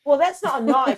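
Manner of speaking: clumsy put-on Aussie accent; Australian accent. Echoing the Crocodile Dundee quote, mock Australian accent. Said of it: "is Australian".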